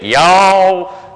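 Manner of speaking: thick Southern "y'all," stretched high and loud; Southern drawl. Retold or imitated. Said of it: "this really thick".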